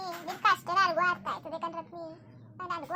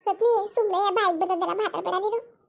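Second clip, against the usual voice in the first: much louder and higher, fast rebuke; loud scolding; 4 semitones higher, 9 dB louder than usual. Acted. Elder scolding Ratni for saying ill-omened things, loud fast rebuke. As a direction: shout her down for talking nonsense.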